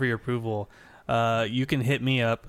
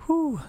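high whistling whew; whew sigh. Real, not acted. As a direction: let out a long whew. Reacts to wives guesting.

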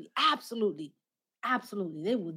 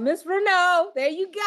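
loud high laugh; loud laughing at the neighbor's prophecy; 11 semitones higher, 7 dB louder than usual. Real, not acted.

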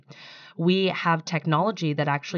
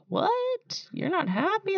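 high-pitched mock shock at a question; retold disbelief; 3 dB quieter, slower than usual. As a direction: squeak it out in disbelief. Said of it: "and I'm like".

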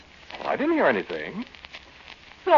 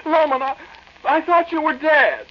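frantic relief; 11 semitones higher than usual. Acted. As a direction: gush with panicked relief. Relieved friend is alive.

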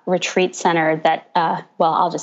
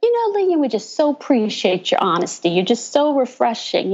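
pitched-up Southern imitation, stretching the so; lightly mocking Southern politeness, higher; Southern accent; 4 semitones higher than usual. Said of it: "kept saying in the Southern accent".

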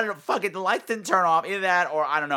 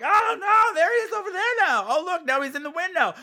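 much higher, fast hammed-up fright; mock alarm; 14 semitones higher than usual. Mocking the cast spotting the baboon, hammed-up fright. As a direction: squeal like panicked B-movie extras. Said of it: "they're all like".

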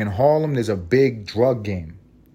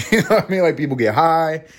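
laughing; 6 semitones higher than usual. Real, not acted. Laughing at story.

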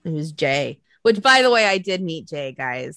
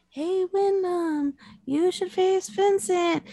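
slow, higher, put-on sweet fan voice; mock fan voice; 8 semitones higher, slower than usual. To fans, a higher, drawn-out coaxing fan voice. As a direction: coax it out like a cheeky fan. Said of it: "just be like".

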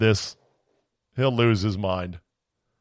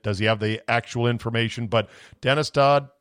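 ordinary delivery

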